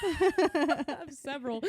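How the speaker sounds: real laughing fit, drawn out; crying with laughter. Real, not acted. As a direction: laugh helplessly while getting the words out. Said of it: "I'm crying".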